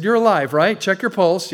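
put-on preacher telling the young man to check his pulse; insistent. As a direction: jolt him awake with it.

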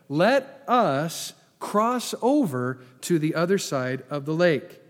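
"Let us cross over" slow and deliberate; emphatic re-reading. Shown as a demonstration. Preacher repeating the familiar lake's verse, "cross over" slow and emphatic. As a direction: space out "other side of the lake", firmly.

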